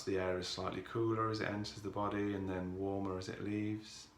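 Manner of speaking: calm, softened voice with long pauses; guided meditation. Real, not acted. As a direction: ease listeners into stillness, unhurried and even.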